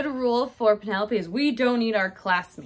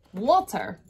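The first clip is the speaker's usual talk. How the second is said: usual speaking voice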